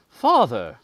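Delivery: sharp loud reproach; reproachful cry. A narrator's reading. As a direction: call out to the father, hurt and reproving. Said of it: "exclaimed Gabriel reproachfully".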